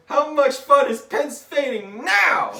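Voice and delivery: slow, very high, loud taunt; gloating yell. Acted. Deadpool gloats after he kills Tom, shouting slowly. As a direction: bellow it slowly, hammed up.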